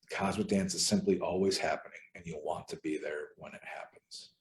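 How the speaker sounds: usual speaking voice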